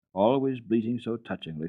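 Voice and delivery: ordinary delivery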